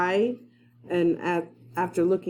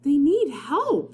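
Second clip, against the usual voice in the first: put-on boy Jack; 8 semitones higher, slower than usual. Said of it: "said Jack".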